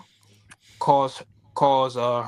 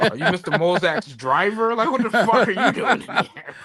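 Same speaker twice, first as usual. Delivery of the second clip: mocking snooty waiter; 4 semitones higher, 4 dB louder than usual. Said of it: "they go".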